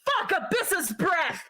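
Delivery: high yelp of pain and cursing; a cry of pain, then swearing. Acted. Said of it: "And you hear her go".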